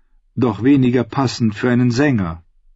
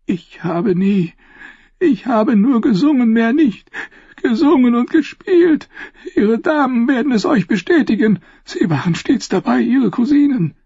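very high, tearful, halting denial; desperate pleading; 12 semitones higher than usual. A narrator's reading. Tortured singer protests to the queen, pleading. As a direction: plead your innocence in terror.

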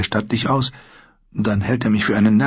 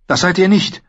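a higher, defiant retort; 12 semitones higher than usual. A narrator's reading. Sansa to King Joffrey, defiantly contradicting. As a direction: throw the denial back at him. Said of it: "entgegnete sie trotzig".

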